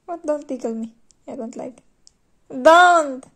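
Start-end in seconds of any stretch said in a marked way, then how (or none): 2.5-3.2 loud protest. Real, not acted. Protesting tickling.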